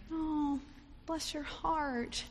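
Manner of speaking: mock sugary sympathy from an unkind relative; fake sympathy. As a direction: coo it with hollow pity. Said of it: "She doesn't show sympathy".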